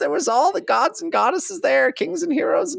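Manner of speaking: very high, silly crazed god; high-pitched cartoon raving, speeding up; dying god's crazy voice. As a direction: rattle it off in a squeaky mad voice. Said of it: "crazy voice".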